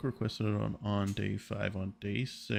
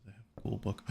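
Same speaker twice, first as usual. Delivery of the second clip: ordinary delivery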